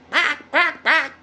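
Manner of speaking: high loud bark-like caw; imitating a crow. Reader shows children how the crow calls, high and loud. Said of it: "Cawed means they went".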